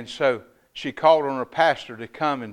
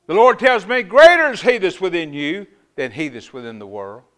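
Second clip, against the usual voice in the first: full-force preaching; 2 semitones higher, 8 dB louder than usual. Acted. Pastor proclaiming victory to the church, loud. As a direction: lift the church with it.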